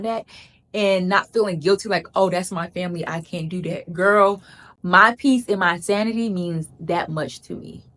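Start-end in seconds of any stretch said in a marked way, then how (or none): none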